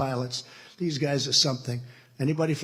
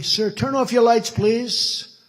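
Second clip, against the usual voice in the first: higher, louder urgent plea; urgent request; 8 semitones higher, 7 dB louder, much slower than usual. Retold or imitated. Pilots urging the president to kill the lights, raised. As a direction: press the order politely but firmly. Pilots warning before landing.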